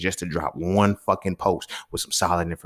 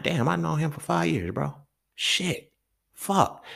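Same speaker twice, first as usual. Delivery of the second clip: stunned friend; 7 semitones higher, 4 dB quieter, slower than usual. Retold or imitated. Quoting friend in disbelief.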